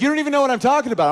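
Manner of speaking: put-on high, loud, rapid-fire girlfriend; imitating an indignant girlfriend at high speed; arguing girlfriend. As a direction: fire back as the girlfriend in a fight. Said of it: "And she's like".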